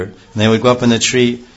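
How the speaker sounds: ordinary delivery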